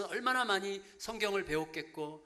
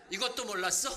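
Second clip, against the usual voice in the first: put-on scolding child; 4 dB louder than usual. Six-year-old scolds parents.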